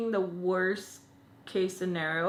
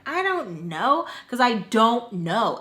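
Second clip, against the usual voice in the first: retold exasperation; 3 semitones higher, 6 dB louder, slower than usual. Granddaughter answering grandma about missing mom, exasperated. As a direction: draw out each word, fed up.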